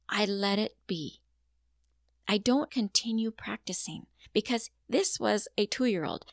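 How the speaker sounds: ordinary delivery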